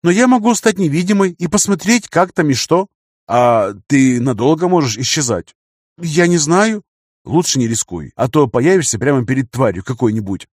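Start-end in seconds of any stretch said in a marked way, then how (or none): 0.0-2.9 put-on higher, lighter young woman's voice; girl character voice. Female character voice. 6.0-6.8 put-on higher, hesitant young woman; unsure girl voice.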